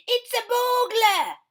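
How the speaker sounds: high, loud indignant dad; Mister Blair. Acted. Mister Blair finding his cereal eaten, high and loud. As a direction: boom out the complaint. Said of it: "said Mister Blair".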